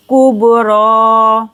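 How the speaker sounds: कुबरा slow, bounced b and long raa; chanted कुबरा with echoing b; qalqala, stretched ending. Shown as a demonstration. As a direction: bounce the b of कुबरा and hold the final vowel. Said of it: "बा पर करेंगे कलकला और आ को खींच कर पढ़ेंगे".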